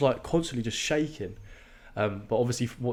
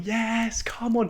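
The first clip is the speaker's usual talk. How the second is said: cheering crowd; 12 semitones higher, much slower than usual. Retold or imitated. Imitating spectators cheering him on.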